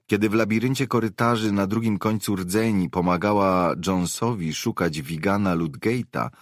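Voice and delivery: ordinary delivery, nothing marked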